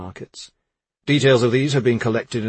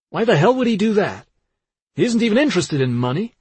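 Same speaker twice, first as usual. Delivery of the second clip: high, loud, heated disbelief; indignant protest; 9 semitones higher, 5 dB louder, faster than usual. A narrator's reading. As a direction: defend your brother in outrage. Dan indignantly defends his accused brother.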